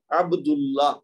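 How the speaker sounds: عبداللہ with a throaty ع at the start; ع onset. Shown as a demonstration. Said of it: "تو یہ عین ہے". (عین سے عبداللہ)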